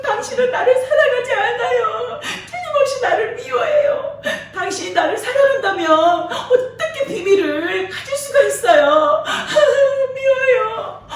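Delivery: high, whiny mock sobbing; fake crying. Delilah weeps to make Samson tell her secret, whining high. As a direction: sob and whine to guilt him. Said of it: "울기 시작한 거예요".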